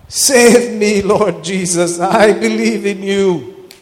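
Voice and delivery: mild retold cry. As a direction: call out for help, lightly acted. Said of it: "he cried out".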